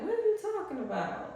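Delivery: high, baffled question; incredulous. Retold or imitated. Speaker to viewers, retelling her disbelief at broke claims, high and baffled. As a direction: react as if they're clearly wrong. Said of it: "I'm like".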